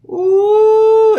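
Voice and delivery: long, high "ooh" of pain; drawn-out high holler; loud high howl. Retold or imitated. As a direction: hoot loudly at the remembered bite. Howl recalling the dog bite.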